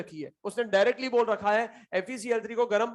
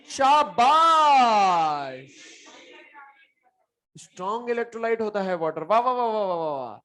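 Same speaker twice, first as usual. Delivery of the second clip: sarcastic praise; 2 semitones higher, 4 dB louder than usual. Real, not acted. Mocking a wrong student answer.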